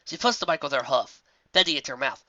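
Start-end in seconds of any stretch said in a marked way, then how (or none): none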